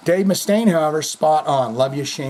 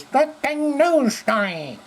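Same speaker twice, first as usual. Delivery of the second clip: high, slow mocking voice; mock news rant; 11 semitones higher, slower than usual. Host mocking the fearmongering news, high and slow. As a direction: sneer it out in a squeaky voice, drawn out.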